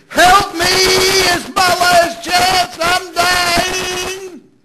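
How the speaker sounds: high, drawn-out shouted plea for mercy; desperate shriek. Retold or imitated. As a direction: cry out at full force, imitating a dying beggar. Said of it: "One version said he shrieked, begged for mercy".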